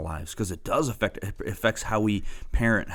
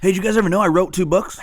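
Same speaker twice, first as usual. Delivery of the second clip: high, loud, rushed brag; mock boast; 11 semitones higher, 10 dB louder, faster than usual. Teasing the other speaker about his books, mock boast. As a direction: blurt it out as a showy, teasing boast.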